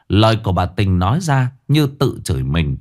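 ordinary delivery

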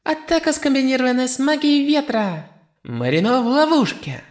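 very high, excited goddess announcer; shrill commentator shout. A narrator's reading. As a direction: shriek it like a thrilled commentator. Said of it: "пронзительно закричала".

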